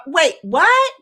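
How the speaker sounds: high, loud disbelief; double-take, voice jumping up; astonished. Acted. Player hearing about the gift, astonished. Acted astonished reaction in banter.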